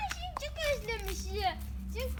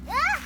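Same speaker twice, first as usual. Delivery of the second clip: sharp ouch when scratched; sudden yelp of pain; 5 semitones higher, 8 dB louder than usual. Real, not acted. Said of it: "kolumu tırnakladı".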